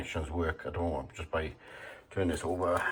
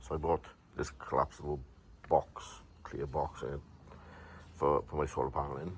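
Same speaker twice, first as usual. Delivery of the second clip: usual speaking voice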